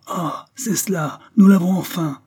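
high cry swelling to a loud shout; savage triumphant cry. A narrator's reading. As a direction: crow in triumph as a mob. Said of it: "cris sauvages triomphants".

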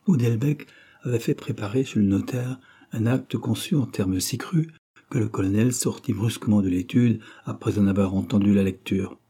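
ordinary delivery, nothing marked

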